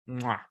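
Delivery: soft, smacked kiss noise; a quiet "mwah" of approval; chef's kiss. Shown as a demonstration. Diner praising the salsa, chef's kiss.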